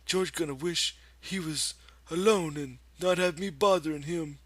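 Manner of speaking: a big simple man talking to himself; Lenny's voice. A narrator's reading. Lenny alone by the pool, talking to himself, in a high childlike voice. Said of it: "he said".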